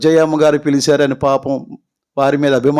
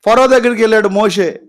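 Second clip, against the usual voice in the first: ordinary delivery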